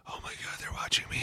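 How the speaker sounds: whispered inner panic, imitated; nervous whisper. Player watched by a packed tiny room, nervous whisper. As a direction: whisper it like a private freak-out.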